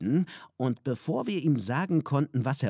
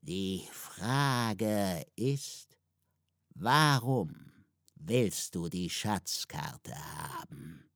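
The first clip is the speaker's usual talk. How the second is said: lower, very slow, eerie voice; talking cauldron; 5 semitones lower, 3 dB quieter, much slower than usual. A narrator's reading. Cauldron asks why the map is wanted, low and slow. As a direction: ask it slowly like an old magic pot. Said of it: "sprach der Kessel".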